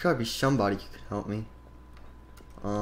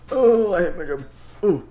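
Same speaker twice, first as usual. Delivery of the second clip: yelp; 6 semitones higher, 8 dB louder than usual. Acted. Character yelps in pain.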